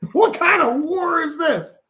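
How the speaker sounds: high-pitched, incredulous outburst; acted bafflement. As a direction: play a commander at a loss over the enemy. Enemy commander baffled by silence.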